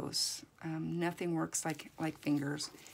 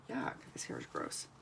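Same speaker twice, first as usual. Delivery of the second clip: low whispered yuck; disgusted whisper; 8 dB quieter than usual. Real, not acted. Disgusted aside about hair.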